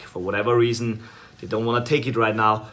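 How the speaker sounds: ordinary delivery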